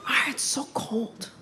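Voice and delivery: a hint of a worried mom; lightly voiced nervous mom. Mom character voice continued.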